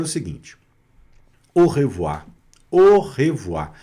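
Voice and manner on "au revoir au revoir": "au revoir" modelled with AU as one O sound. Shown as a demonstration. Teacher shows students the vowel junction, "au" sounded as O. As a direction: say "au revoir" opening on a held O. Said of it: "o A mais U vira O".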